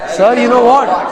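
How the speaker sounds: eager, surprised telling of news; lively "guess what" to the teacher; excited surprise. Shown as a demonstration. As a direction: say it like you can't wait to share. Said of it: "जैसे मैंने बोला है, वैसे ही बोलना है".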